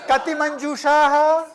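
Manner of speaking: high, loud questioning call to students; teacher's question to the class. Shown as a demonstration. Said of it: "asks the class a Sanskrit question". (پرسش بلند و زیر معلم از کلاس)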